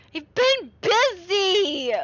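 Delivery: high, slow, loud nagging mother; mock mom, drawn out.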